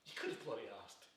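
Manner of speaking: low, soft, wounded reproach; quiet reproach. Acted. Man to friend who never asked him, quietly reproachful. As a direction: say it low and hurt, falling away.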